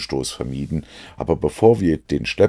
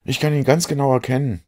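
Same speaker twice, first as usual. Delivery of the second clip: much higher, eager call; excited Watson; 10 semitones higher than usual. A narrator's reading. Watson tells Holmes he sees the boy, higher and eager. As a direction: point him out eagerly.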